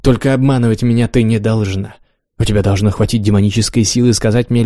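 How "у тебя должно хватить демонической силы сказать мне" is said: ordinary delivery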